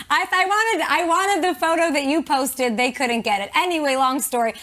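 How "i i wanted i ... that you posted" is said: high, laughing through the words. Real, not acted. Host laughing at a photo of her in heels.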